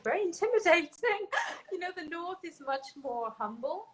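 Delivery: laughing through the words, pitch jumping up. Real, not acted. Guest laughing about posh classmates to interviewer, laughing. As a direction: chuckle while admitting it intimidated you. Recalling intimidating posh university.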